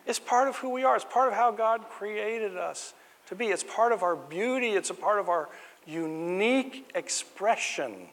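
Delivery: preacher rising to a high proclamation; fervent preaching. Acted. Preaching about human uniqueness.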